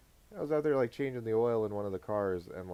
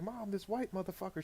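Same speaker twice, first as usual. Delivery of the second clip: son tattling; 9 semitones higher, 6 dB quieter than usual. Retold or imitated. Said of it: "he was like".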